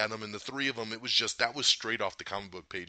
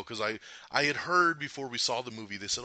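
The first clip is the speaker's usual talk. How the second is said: normal talking voice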